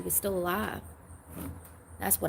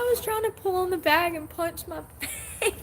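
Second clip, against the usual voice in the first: laughing; 8 semitones higher than usual. Real, not acted. Laughing about punching her face.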